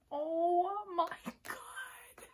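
slow, high breathy whisper; drawn-out awestruck whisper; awed whisper. Real, not acted. Overwhelmed by photobook.

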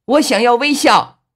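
very high, loud desperate yell; full-force high holler; all-out shout. A narrator's reading. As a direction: shout it for all you're worth. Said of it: "拼了命的呀，大声喊道".

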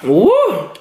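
coughing after swallowing wrong; choking cough, food down wrong pipe; swallowed wrong way. Real, not acted. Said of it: "Went down the wrong hole".

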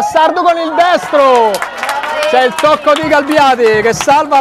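excited. Real, not acted. Shot on counterattack.

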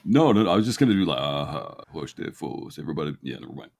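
ordinary talk

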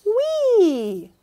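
high, loud "Wee"; gleeful whoop. A narrator's reading. Storyteller voicing a letter's gleeful whoop. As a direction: squeal it with delight.

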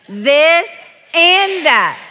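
loud "This", pause, then "and" pitched high; emphasis on "and". Shown as a demonstration. Preacher showing the crowd how, emphatic stress on "and". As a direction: hit "and" big and drawn high. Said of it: "put an emphasis in the "and".

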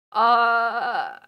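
pained shouted groan; anguished cry. Real, not acted. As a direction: let out a distressed wail. Distressed cry over client.

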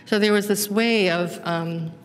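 ordinary talk

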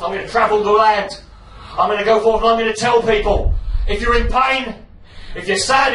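hammed-up sermon to the masses; preaching. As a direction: proclaim it like a street evangelist. Preaching as a born-again convert.

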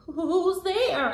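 ordinary delivery, nothing marked

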